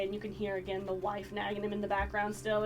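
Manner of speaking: ordinary talk, nothing marked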